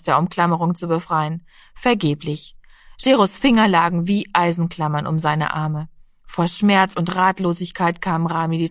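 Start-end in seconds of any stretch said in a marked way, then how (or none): none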